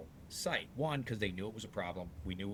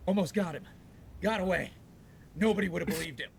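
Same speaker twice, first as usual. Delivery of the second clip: imitating a winded, heavy-breathing chase, higher and louder; 10 semitones higher, 7 dB louder than usual. Salesman Dave back from chasing the customer, mock winded. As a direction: puff it out like you just ran the blocks. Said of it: "he was breathing really heavy".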